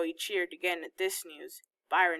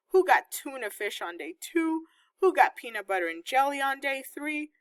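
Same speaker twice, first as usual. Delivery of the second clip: ordinary delivery, nothing marked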